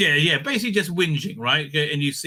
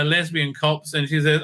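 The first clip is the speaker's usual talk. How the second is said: ordinary talk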